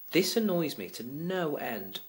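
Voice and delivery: ordinary delivery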